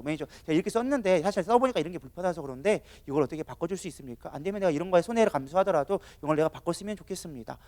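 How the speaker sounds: soft, even customer complaint; calm composure. Acted. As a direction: explain politely without any anger. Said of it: "컨트롤하는 사람들은 그렇게 하지 않아요". (차분하게 설명하는 고객 말투)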